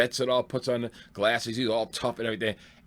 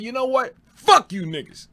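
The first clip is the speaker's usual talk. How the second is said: loud, higher angry shout at the crowd; angry retort; 13 semitones higher, 8 dB louder than usual. Retold or imitated. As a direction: snap back at the hecklers, furious. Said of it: "but I'm mad".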